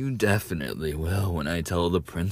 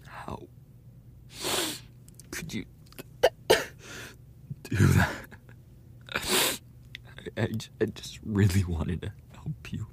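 hurt whisper; 3 dB quieter, faster than usual. Acted. Rejected, stammering justification.

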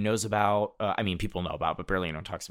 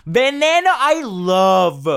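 high, loud burst of delight at the title; excited; 12 semitones higher, 9 dB louder, slower than usual. Real, not acted. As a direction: squeal the name with joy. Excited echo of favourite show.

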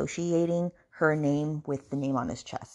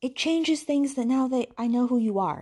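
raised, louder mock declaration; imitating the man; 7 semitones higher, 4 dB louder than usual. Man telling her things have changed, mock earnest. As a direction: quote him in a hammed-up higher voice. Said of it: "he's all like".

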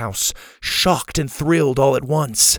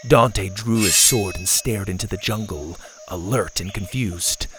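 ordinary delivery, nothing marked

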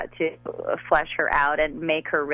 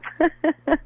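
light laugh; 9 semitones higher than usual. Real, not acted. Laugh after quote.

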